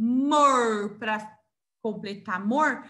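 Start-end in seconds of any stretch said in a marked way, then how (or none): 0.0-1.0 "mor" drawn out and loud; teacher sounding out "mor" with emphasis; syllable demo. Shown as a demonstration. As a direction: stretch the O in "mor". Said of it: "eu preciso da letrinha O".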